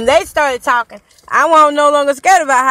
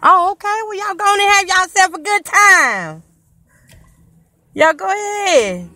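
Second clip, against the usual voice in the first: calling out; 8 semitones higher than usual. Real, not acted. Calling goodbye to girls.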